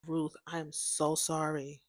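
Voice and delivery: very soft, low and slow sympathy; gentle condolence. Retold or imitated. Consoling friend whose aunt died, gentle condolence. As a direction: console her softly, voice dropped low.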